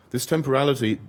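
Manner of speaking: ordinary talk, nothing marked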